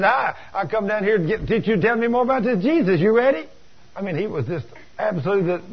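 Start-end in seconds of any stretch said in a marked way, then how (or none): 0.0-3.5 high, climbing, eager greeting; wildly eager kid. Retold or imitated. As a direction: burst in impatient to hear everything. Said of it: "he was just out there".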